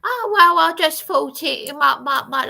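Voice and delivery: high, loud, stammering excuse; a flustered woman making excuses, pitched high; mock flustered excuse. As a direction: imitate her caught out and fumbling. Mimicking Dawn's flustered excuse.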